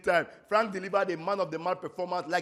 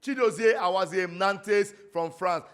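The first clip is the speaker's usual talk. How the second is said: ordinary delivery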